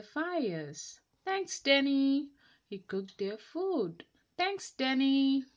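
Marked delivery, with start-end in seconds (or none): 1.2-2.3 higher, louder thank-you; grateful villager. Acted. 4.4-5.4 higher, louder thank-you; grateful villager. Acted.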